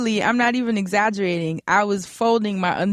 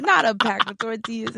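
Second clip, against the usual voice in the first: ordinary talk, nothing marked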